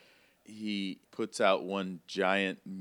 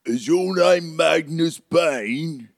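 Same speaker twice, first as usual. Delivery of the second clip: loud, slow, raised booming call; hulking giant; 8 semitones higher, 8 dB louder, slower than usual. Acted. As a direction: boom it out slowly like a huge stranger. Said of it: "hulking behemoth".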